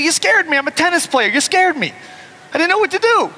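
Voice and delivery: high, whiny pleading; mock scared. [name] excusing himself to Big Jim in a whiny high voice. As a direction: plead like a frightened kid. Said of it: "I said".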